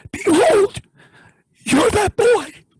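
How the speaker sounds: high, slow, solemn declaration; prophetic proclamation. Acted. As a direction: announce it like a prophet's revelation. Preacher's solemn revelation to man.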